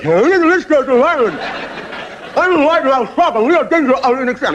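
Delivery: high, wide-mouthed frog voice. Acted. Frog spokesman pleading to Ian Smith, high and wide-mouthed. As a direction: stretch the mouth wide and squeak it out. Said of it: "So off he goes".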